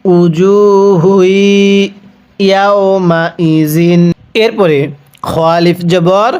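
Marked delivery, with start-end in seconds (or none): 0.1-4.0 each sound drawn out slowly; teacher reads it slowly for students to copy; slow Quranic reading. Shown as a demonstration. Teacher shows the reading, very slowly. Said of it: "কিভাবে পড়তে হবে?".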